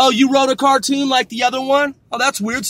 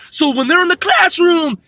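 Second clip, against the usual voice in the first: mock little cartoon girl; 7 semitones higher than usual. Ranter shows how the show's girl talks, high mock shout. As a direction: parody her raised sentence ending. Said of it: "is her talk like this".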